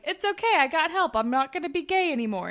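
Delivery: high, bright-eyed mock eagerness; chirpy, overconfident insisting; lightly hammed eager teen; eager reassurance.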